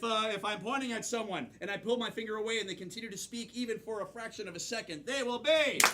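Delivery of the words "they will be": catchphrase cue. Real, not acted. Host cues audience catchphrase.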